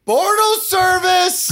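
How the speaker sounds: high, sustained hammed-up call; playful high-pitched holler, held long; drawn-out catchphrase call. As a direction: stretch it out high like a silly catchphrase.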